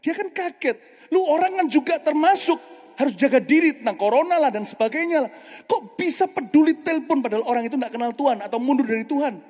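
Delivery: impassioned sermon delivery; preaching at full force. Acted. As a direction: press the point with fervour. Preacher building intensity.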